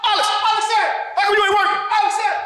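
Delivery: very high, insistent hollering; acted yelling. Cousin yelling at what he thinks is Alex, high hollering. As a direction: holler the name in high bursts. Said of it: "I hear him yelling".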